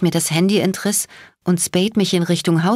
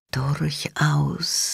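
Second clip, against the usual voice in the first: put-on softer, a cold hiss with an oriental accent; a menacing hiss down the line; hissing woman; 4 dB quieter than usual. A narrator's reading. The enemy answers Bones' phone, hissing coldly. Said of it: "Es war mir ein Zischen".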